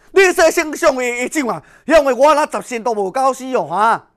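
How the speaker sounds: desperate loud plea. Acted. Yang Zhong begs Bao Gong desperately.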